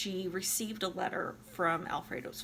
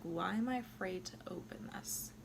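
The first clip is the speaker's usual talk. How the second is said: whisper; 6 dB quieter than usual. Retold or imitated. Alfredo fearing the letter.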